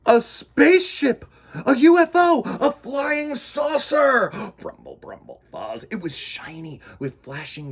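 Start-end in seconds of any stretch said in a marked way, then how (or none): 0.1-4.3 much higher, louder amazement; amazed. Acted. 4.6-5.9 low, soft rumbling sound; alien noise. Acted.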